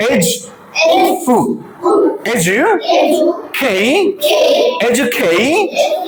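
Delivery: এডুকেশন built up slowly, "ej, u, eju, kay"; syllable by syllable. Shown as a demonstration. Teacher repeats with the class, syllable by syllable. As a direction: drill এডুকেশন in pieces with a j sound. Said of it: "Let's say".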